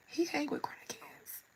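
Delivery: high, whispered aside to herself. Retold or imitated. Teen silently asking her friend about the crying man, in a high whisper. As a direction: whisper it like a secret joke. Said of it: "and I'm just sitting here like".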